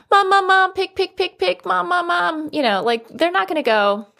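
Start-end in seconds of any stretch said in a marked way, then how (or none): 0.1-2.5 put-on yelling toddler. Toddler in bed yelling for mom to come back, mock shout. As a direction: shriek for Mom like a two-year-old. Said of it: "They're gonna yell at you".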